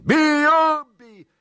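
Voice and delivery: very loud, high-pitched shriek; mob yell. A narrator's reading. Drunken mob sets upon its victims, yelling. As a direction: scream it to urge the mob on. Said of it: "krzyczały pijane głosy".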